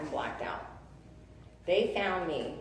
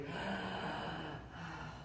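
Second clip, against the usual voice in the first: long soft sigh; 11 dB quieter than usual. Real, not acted. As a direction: let the breath out slowly as peace arrives. Reliving the moment peace came.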